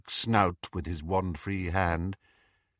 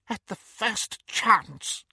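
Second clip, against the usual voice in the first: high, slow, fervent vow; Bellatrix voice; 13 semitones higher, slower than usual. Acted. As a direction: promise it with zeal.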